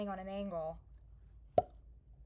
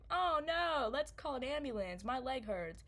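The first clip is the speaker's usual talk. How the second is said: mock distress; 4 semitones higher than usual. Storyteller imagining calling an ambulance as a joke, mock distress. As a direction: play up a theatrical cry for help. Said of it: "I wish I was joking".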